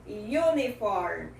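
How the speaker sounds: UNIFORM slowly, starting 'yoo'; slow model of UNIFORM as 'yoo-ni-form'; consonant Y sound. Shown as a demonstration. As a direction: draw out the Y glide of UNIFORM. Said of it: "que é o som da consoante Y".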